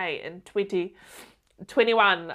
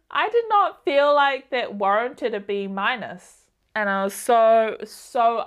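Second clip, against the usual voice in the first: frustrated; 3 semitones higher, 7 dB louder than usual. Real, not acted. Venting about an unfair B minus.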